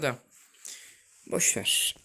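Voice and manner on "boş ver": whispered shrug. Real, not acted. Muttered resignation.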